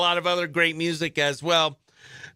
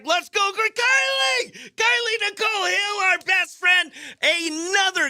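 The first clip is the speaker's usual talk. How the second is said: big on-air shout-out to a best friend; excited shout; 13 semitones higher than usual. Real, not acted. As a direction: yell her name across the studio like a hype man. Radio host welcomes co-host Kylie.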